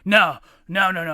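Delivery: loud quick protesting no's; mock loud denial. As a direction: wave it off loudly, defensive. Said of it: "And he's like".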